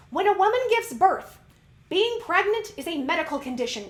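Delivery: loud, high outrage with punched single words; really fired up, demand answers; ranty. Real, not acted. Host demanding why women's hormones are ignored, ranty and outraged. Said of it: "I told you I'm gonna get ranty".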